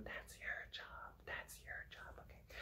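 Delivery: playful whisper; hushed teasing reminder; whispered tease. Acted. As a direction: whisper it like a secret. Teasing aside to students.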